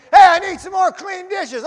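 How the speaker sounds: loud, high shout over the kitchen noise; impatient waiter shouting for clean dishes; waiter yelling. Retold or imitated. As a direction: bark the order across the kitchen. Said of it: "They kept yelling and screaming at me".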